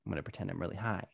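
low, quick, quiet aside to oneself; hushed scheming. Retold or imitated. Planning an escape from a bad date, hushed scheming. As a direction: plot it under your breath, quickly.

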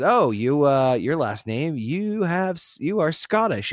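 a lightly voiced, pompous reading of the verdict; booth attendant voice. Cousin hearing the fair booth read his ancestry, mock-pompous. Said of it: "and it said".

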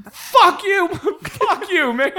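high, laughing mock curse at the heckler; laughing retort. Comic laughing as he curses back at the heckler, high laughing retort. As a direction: laugh it out while cursing him.

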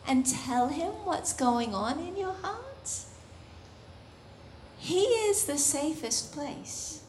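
usual speaking voice

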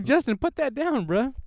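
mock scold. Scolding kid in story.

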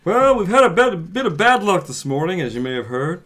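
a touch of a pompous dad; lightly voiced grieving father; stuffy father voice. Reads father role.

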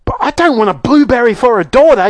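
high-pitched motherly protest; indignant yell. Acted. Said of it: "yelled Missus Beauregard".

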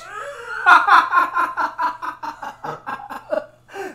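loud high laugh fit; cracking up hard at a joke; howling laughter. Real, not acted. Laughing loudly at the penis pouch.

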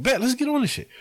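high, eager giving-in; a high, keyed-up caving in; eager agreement. Retold or imitated. Finally agreeing to ride, high and eager. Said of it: "I'm like".

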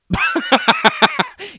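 shrill squeal of a laugh; high shrieking laugh. Real, not acted. Podcaster laughing at her own disclaimer, high squeal. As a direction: let out a squealing cackle.

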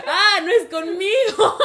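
high, very loud, stretched realization; laughing cry of relief; exaggerated relief. Student realizing teacher isn't after her, loud comic relief.

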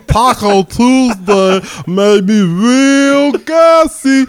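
slow, lazy drawl; lumbering slow comic voice; fat lazy guy. Acted. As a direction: drag every word out like a big lazy guy. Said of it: "gonna give you fat lazy guy".

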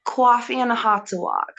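tentative East Coast American accent, somewhat New York; Boston accent attempt. Shown as a demonstration. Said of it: "a Bostonian accent from the United States".

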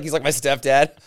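ordinary talk

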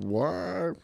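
high, soft mock what; stunned disbelief. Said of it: "I was like".

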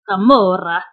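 Camorra with a Spanish accent, rolled r. Shown as a demonstration. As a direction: say Camorra the Spanish way, crisp vowels. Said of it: "I can do that one with my Spanish accent".

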